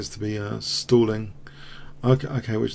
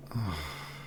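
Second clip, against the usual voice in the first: heavy sigh; 5 semitones lower, 12 dB quieter than usual. Real, not acted. After losing.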